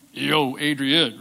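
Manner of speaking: low, slow Rocky Balboa voice; Rocky Balboa impression. Pastor imitating Rocky to explain Peter's name, low and slow. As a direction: drawl it like a tough boxer from Philly. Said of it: "like Rocky Balboa".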